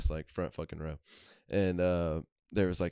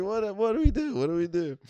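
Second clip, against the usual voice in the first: much higher flustered squeak; mock panic; 12 semitones higher, 4 dB louder than usual. Kids caught by security, high mock panic. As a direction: scramble in fake panic.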